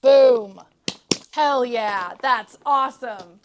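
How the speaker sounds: loud proud victory shout; triumphant cheer. Real, not acted. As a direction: celebrate the win loudly. Triumph after wrestling the bag.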